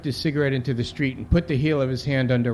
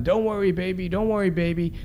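imitated mother's yell; 6 semitones higher than usual. Mother yelling comfort to her son, restrained imitation. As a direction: call out high to comfort your child. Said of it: "she's yelling".

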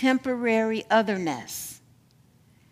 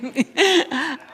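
real laugh; 5 semitones higher than usual. Real, not acted. Laughs at needing it too.

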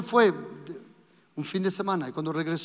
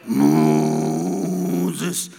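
very deep, drawn-out booming call; voice of God; 6 dB louder than usual. Retold or imitated. God calling Moses in the cinema, very deep and rolling. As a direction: drop far lower and let the name roll out. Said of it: "¿Os acordáis?".

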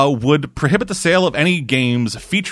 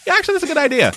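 mock agreeable crowd; much faster than usual. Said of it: "then everybody else will be like".

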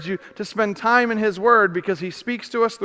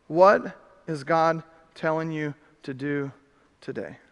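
normal talking voice

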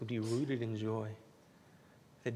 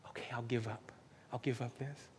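whispered surrender; 5 dB quieter than usual. Acted. Inner voice giving in.

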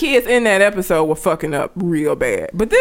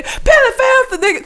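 much higher, barked imitation of a prisoner; mock prisoner bark. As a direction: bark the order like a bully inmate. Prisoners bullying the kid.

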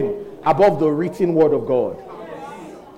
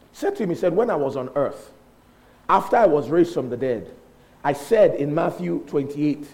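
normal talking voice

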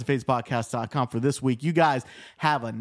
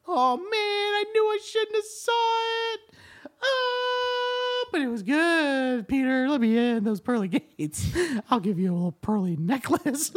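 high whiny sinner voice; 10 semitones higher than usual. Retold or imitated. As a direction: plead at heaven's gate in a squeaky voice. Voicing a soul at heaven's gates.

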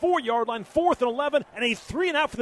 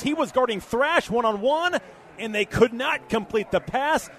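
normal talking voice

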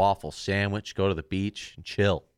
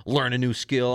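normal talking voice

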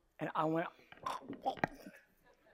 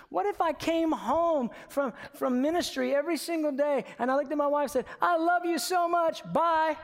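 higher, louder fervent preaching; preaching at full force; 7 semitones higher, 4 dB louder than usual. Acted. Pastor urges church to love in deed, fervent preaching. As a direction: drive it home to the congregation.

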